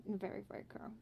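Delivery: soft breathy whisper. Real, not acted. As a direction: whisper it almost to nothing. Stressing how curly the hair is.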